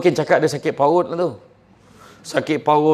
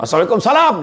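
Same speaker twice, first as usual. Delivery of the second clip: gruff angry-sounding greeting; 6 semitones higher, 6 dB louder, faster than usual. Retold or imitated. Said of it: "Dia, dia jenis macam tu. Dia tak marah".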